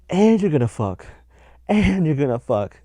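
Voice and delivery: loud, high burst on each phrase; hammed-up shouted punchline; mock emphatic prediction. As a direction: belt it out as an over-the-top tarot promise. Exaggerated punchline of tarot reader imitation.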